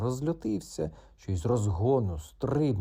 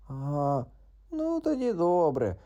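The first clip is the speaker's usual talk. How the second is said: higher unsure frog; doubtful frog; 7 semitones higher, 3 dB louder, slower than usual. Acted. Frog accepts doubtfully, higher. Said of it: "сумнівом прокумкав".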